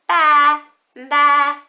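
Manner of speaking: loud bleating ba; lamb bleat. Shown as a demonstration. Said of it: "What does the lamb say?".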